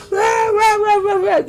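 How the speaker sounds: high-pitched "rah rah" rant; mock ranting noise. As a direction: imitate a scolder yapping on, high. Imitates an imagined scolding rant.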